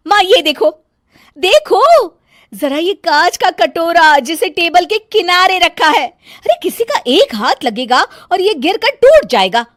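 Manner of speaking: high, loud complaining bahu voice; bossy bahu fussing over nothing; nagging daughter-in-law. Acted. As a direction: scold the whole family, shrill with irritation. Megha complains loudly.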